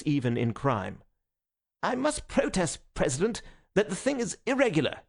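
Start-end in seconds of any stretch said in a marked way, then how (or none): 1.8-5.0 high-pitched indignant objection; agitated protest. A narrator's reading. Conscientious secretary protests to the President, agitated. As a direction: protest with nerves whipped up.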